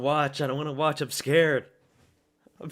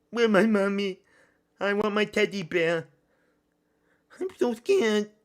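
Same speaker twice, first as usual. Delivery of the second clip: mock scared baby; 5 semitones higher, 3 dB louder than usual. Mock scared child during fight.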